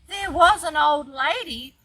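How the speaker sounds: loud, high, slow and drawn-out narration; dramatic storytime climax. A narrator's reading. Grandma reading the shocking last verse to her grandchild, dramatic. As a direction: build the big shock for a little listener.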